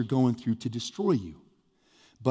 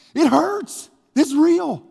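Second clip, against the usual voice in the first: loud, high-pitched mock complaint; hammed-up, pained pleading; whining protest; 14 semitones higher, 8 dB louder, much slower than usual. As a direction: insist on it in an acted-out wail. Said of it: "He whines".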